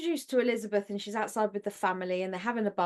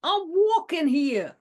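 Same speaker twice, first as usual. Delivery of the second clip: higher, louder, slow New York yell; New York accent; 8 semitones higher, 6 dB louder, slower than usual. Retold or imitated. Showing off her only New York accent, slow New York yell. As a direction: bellow it like a New York cabbie. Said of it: "one New York accent".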